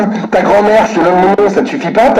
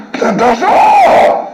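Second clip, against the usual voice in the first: furious shout; 5 semitones higher, much slower than usual. Real, not acted. Caller yelling alone about the cost of the call, slow furious shout. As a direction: drag out a furious yell, word by word.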